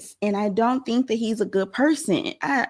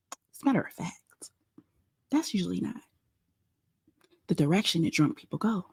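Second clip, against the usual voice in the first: whispering; 8 dB quieter than usual. Real, not acted. Confiding aside to viewers.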